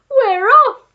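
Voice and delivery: high, very loud excited cry; monkey crying out. A narrator's reading. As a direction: shout it high, like an eager monkey. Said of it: "cried the monkey".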